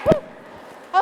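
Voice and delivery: high puff of relief; winded whew. Real, not acted. Leader recovering after the action song, winded whew. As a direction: blow out a breathless whew.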